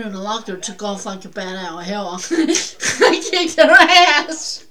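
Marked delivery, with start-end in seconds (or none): 2.3-4.7 laughing it out, higher and louder; proud laughing brag; laughing boast. Real, not acted. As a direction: crack up while bragging.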